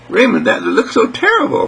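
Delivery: put-on a touch of girlish dismay; lightly voiced little girl. Marilee objects to her brother Raymond, mildly dismayed.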